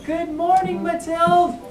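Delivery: high singsong greeting; little mice. Acted. Mice greet Matilda the hen, in high little voices. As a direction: pipe it up high like small mice.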